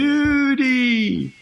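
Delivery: high, long-drawn cry; mock alarm call. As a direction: call it out high, stretching the vowels. Acting out a pool panic cry.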